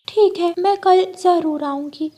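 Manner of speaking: high, cheerful bird voice; parrot friend voice. Acted. Hariyali answering Rano's invitation, high cheerful voice. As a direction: chirp back eagerly.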